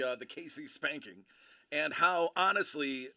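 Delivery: ordinary delivery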